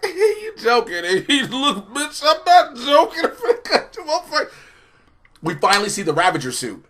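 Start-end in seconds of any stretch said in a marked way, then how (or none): 0.0-4.5 laughing mock voices. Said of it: "she was like".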